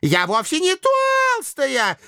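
very high offended squeal; thin high voice of an affronted maiden; flaring up, womanly. A narrator's reading. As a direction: protest slowly in wounded vanity. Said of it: "чисто по-женски вспыхнула Нерейда".

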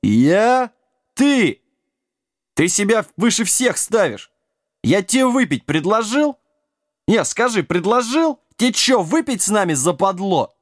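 high-pitched heated challenge; indignant quarrel. A narrator's reading. Drinkers quarrel over refused drink, indignant.

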